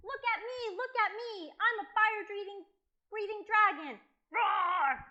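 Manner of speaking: put-on high, loud child's bragging into a roar; boastful pretend dragon. Boy showing the dragons he is big, high boastful shout.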